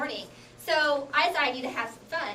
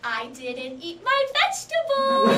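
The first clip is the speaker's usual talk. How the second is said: put-on slow, higher, eerie voice to scare a kid; spooky warning voice; 10 semitones higher, 4 dB louder, slower than usual. Comedian spooks a four-year-old, slow and eerie. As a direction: drag it out like a ghost story.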